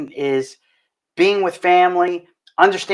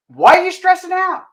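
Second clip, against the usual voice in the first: exasperated question; 12 semitones higher, 4 dB louder than usual. Real, not acted. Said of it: "rhetorical exclaim".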